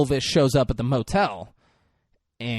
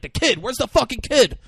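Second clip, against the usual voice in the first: angry demand; 11 semitones higher, 4 dB louder than usual. Retold or imitated. Bruce Willis grabs him.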